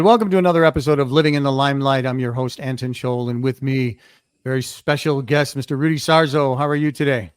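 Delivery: ordinary talk, nothing marked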